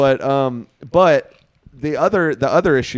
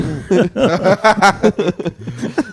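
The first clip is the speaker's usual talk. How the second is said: laughing; 7 semitones higher, 6 dB louder than usual. Real, not acted. Laughing at a joke.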